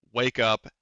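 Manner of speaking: ordinary talk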